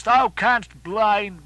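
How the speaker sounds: slow, weighty thou; slow emphasis. Acted. Ahab challenging the fire, slow and weighty. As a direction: drag out each word of defiance.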